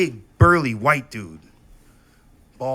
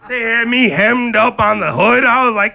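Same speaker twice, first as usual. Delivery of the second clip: ordinary delivery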